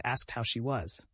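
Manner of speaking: usual speaking voice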